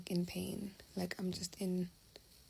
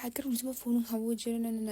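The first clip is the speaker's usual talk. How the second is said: higher-pitched, louder mock rant; imitated yelling; 3 semitones higher, 4 dB louder than usual. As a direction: mimic someone yelling and nagging at you. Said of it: "he's screaming, he's, like, yelling at me".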